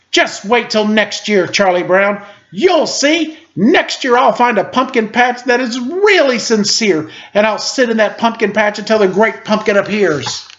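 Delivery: put-on high, earnest child voice; determined Linus. A narrator's reading. Linus vowing to Charlie Brown about next year, high earnest child voice. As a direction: promise it with stubborn faith.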